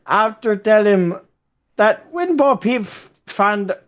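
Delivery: put-on Irish accent, slightly stumbling. Reading a war anecdote to friends, Irish accent. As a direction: read it out in a rough Irish accent. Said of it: "I'll do an Irish accent".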